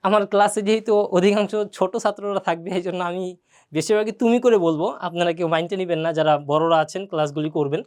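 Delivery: ordinary delivery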